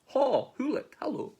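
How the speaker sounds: high-pitched calling out; cheery mouse greeting. Acted. Mouse greeting hoolet, high sing-out call. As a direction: hail a pal in a high voice.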